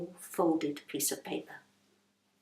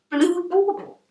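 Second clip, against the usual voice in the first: higher, louder startled question; a sudden sharp surprise; startled Rose; 11 semitones higher, 9 dB louder than usual. Acted. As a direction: caught off guard.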